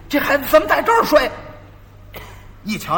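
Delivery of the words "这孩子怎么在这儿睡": higher, louder, fast outraged shout; blowing up. Retold or imitated. Shopkeeper finds apprentice asleep, exploding in anger. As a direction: explode at the sleeping boy. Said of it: "掌柜回来一瞧就炸了".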